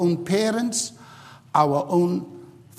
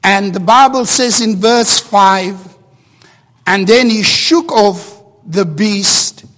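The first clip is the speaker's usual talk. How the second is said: raised, louder preaching voice, driving each point home; full-force preaching; 6 semitones higher, 11 dB louder than usual. Acted. Pastor urging the congregation to shake their past, full-force preaching. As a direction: preach it out with fervour to the whole church.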